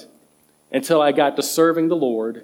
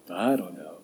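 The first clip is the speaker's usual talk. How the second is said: much lower and softer imitated brush-off; daughter's reply; 9 semitones lower, 10 dB quieter than usual. Repeating the daughter's answer for the congregation, low and quiet. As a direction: imitate a child's low, uninterested non-answer. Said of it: "I'll ask my daughters".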